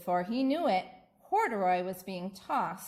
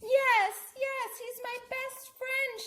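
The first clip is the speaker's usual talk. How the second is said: shouting with joy. Acted. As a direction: burst out with relief. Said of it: "shouted Lisa".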